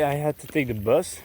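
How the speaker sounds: usual speaking voice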